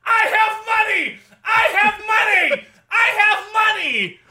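high shrill repeated scream, slow. Acted. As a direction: screech it like an alarm. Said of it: "it screams".

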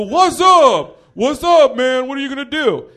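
high, loud mock bro challenge; douchebag bros. Comic mimics the guy's boys squaring up, loud douchebag holler. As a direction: holler like a cocky bro itching for a fight. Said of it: "'Cause they're douchebags".

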